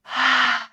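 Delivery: loud open-mouthed sigh; demonstrated release sigh; exhale through the mouth. Shown as a demonstration. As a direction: let the breath out in one short rush. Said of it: "Exhale out through the mouth".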